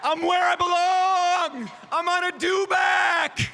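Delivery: high, thrilled shouting; excited shout. Real, not acted. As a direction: shout it with glee from the saddle. Excited host on a dewback.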